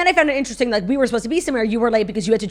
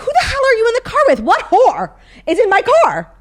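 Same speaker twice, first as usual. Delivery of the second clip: high, louder mock outrage at the boyfriend; mock jealous outburst; 8 dB louder than usual. Girlfriend on the phone, demanding who is in the car, mock outraged. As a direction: play up fake jealousy, loud and high.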